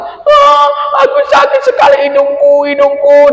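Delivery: very high, loud whimpering complaint; crying out in pain to the porcupine; whining cry. Acted. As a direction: whine like a hurt child. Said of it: "Terus menangis, si Anjani merengek".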